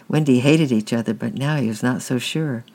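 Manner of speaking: ordinary delivery, nothing marked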